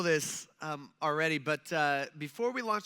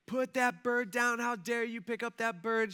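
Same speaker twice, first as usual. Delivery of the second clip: higher-pitched, drawn-out imitation of screaming; mock yelling; 6 semitones higher than usual. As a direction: imitate an outraged woman yelling. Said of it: "starts screaming and starts yelling".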